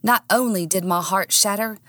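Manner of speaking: ordinary delivery, nothing marked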